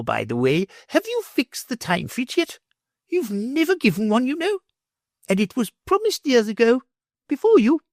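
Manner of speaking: high, imperious noblewoman; lofty lady. A narrator's reading. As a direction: press the professor grandly. Lady voiced by reader.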